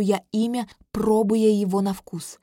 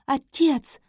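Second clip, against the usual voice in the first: high call to father; 5 semitones higher, 3 dB louder than usual. Acted. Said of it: "снова выкрикивает принцесса".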